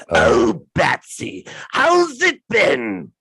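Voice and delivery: high, loud, slow maniacal Joker; Joker impression. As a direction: drag out the taunt like a madman. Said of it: "I can do a really good Joker".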